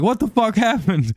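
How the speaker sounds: high, stunned cry of disbelief; shocked disbelief. Retold or imitated. Son seeing former mom walk in, high shocked disbelief.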